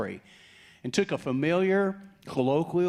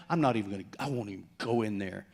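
muttered, pouting refusal, much softer; a pouty mutter under the breath; sulky pout; 6 semitones lower, 4 dB quieter, faster than usual. Acted. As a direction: mumble it like a sulking son. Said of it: "He's pouting, arms folded".

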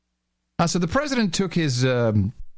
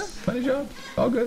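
high, softer gullible agreement; mock naive lady; 11 semitones higher, 5 dB quieter, faster than usual. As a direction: agree as a clueless lady, pitched up. Acts the naive lady again.

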